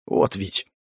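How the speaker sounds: ordinary delivery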